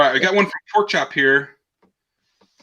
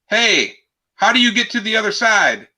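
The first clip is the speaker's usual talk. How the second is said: a mock yell, pitched much higher and louder; yelling across the lake; 9 semitones higher, 5 dB louder than usual. Blonde on one shore calls to the other, mock yell. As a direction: call out across the water to her. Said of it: "the first blonde yells over".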